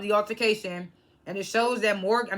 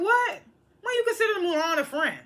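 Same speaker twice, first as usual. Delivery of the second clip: much higher, shocked disbelief; incredulous; 9 semitones higher than usual. Retold or imitated. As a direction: hit the what like you can't believe it. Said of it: "I was like".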